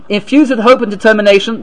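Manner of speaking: ordinary delivery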